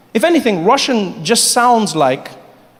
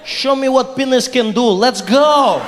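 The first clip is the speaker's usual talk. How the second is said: put-on Russian accent; 6 semitones higher, slower than usual. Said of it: "get your Russian out".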